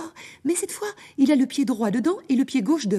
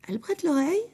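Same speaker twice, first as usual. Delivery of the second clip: ordinary delivery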